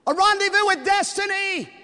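put-on very high, loud old-time orator; FDR impression. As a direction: boom it out like a radio-era president. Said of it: "do it like F D R".

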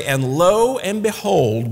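"lo and behold" drawn out slowly; slow storyteller's "lo and behold"; attention-getting. Acted. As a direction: stretch "lo" and "behold" for the listener's attention. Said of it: "really want to get their attention".

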